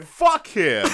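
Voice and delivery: loud, explosive dismissal; angry dismissal. Real, not acted. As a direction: brush off the objection with a shout. Angrily dismissing a friend's counterexample.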